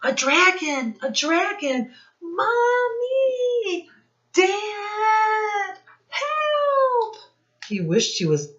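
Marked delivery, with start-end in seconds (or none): 0.0-7.2 high, loud panicked cries for help; a boy king crying out for his parents in fright; frightened yelp. Acted. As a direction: squeal in terror as the dragon appears. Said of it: "King Jack gave a yelp".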